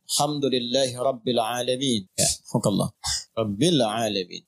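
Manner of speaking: low, chanted Quran recitation. Shown as a demonstration. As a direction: intone it like tilawah. Guru melantunkan bacaan Al-Fatihah. (lantunan tilawah rendah)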